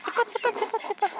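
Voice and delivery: very high sing-song chick-chick call; calling chickens. Real, not acted. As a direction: coax the hens with a high call.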